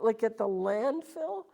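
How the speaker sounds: high, loud disbelief; incredulous. Retold or imitated. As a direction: retell your own shock, pitch jumping way up. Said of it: "And I'm like".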